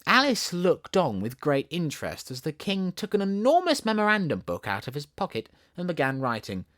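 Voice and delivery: ordinary delivery, nothing marked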